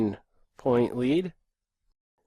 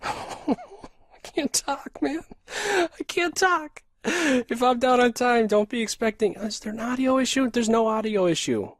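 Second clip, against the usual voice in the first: strained, much higher, rushed-sounding voice; flustered squeak; 11 semitones higher than usual. Real, not acted. Streamer to chat while short on time, high strained squeak. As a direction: voice jumps way up under time pressure.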